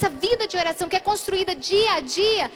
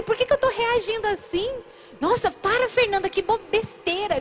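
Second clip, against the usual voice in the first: higher, lightly acted self-reproach; exasperated self-scolding; 6 semitones higher than usual. Woman mid-quarrel tells herself to stop, exasperated. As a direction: imitate pleading with oneself to stop.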